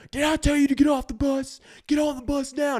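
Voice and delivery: high, loud barking drill sergeant; drill sergeant shout. Retold or imitated. Drill sergeant ordering kids back on the bus, shouted. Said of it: "the sergeant just comes out and goes".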